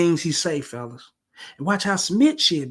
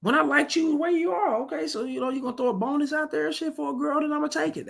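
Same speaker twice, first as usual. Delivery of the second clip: high, softer imitation of her; quoted woman's reasoning; 10 semitones higher, 4 dB quieter than usual. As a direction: mimic her justifying herself, pitched well up. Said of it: "she said it just like that".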